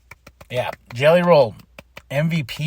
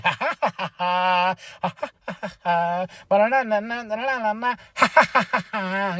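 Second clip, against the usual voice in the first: mocking laugh; 4 semitones higher than usual. Becky laughing at the fooled crowd, mocking cackle. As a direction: gloat with a sing-song mocking laugh. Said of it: "Becky watching ... being like".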